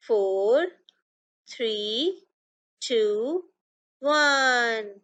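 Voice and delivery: sing-song counting, each number rising; kids show host. Shown as a demonstration. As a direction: chant the numbers slowly for children to follow. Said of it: "Start with me".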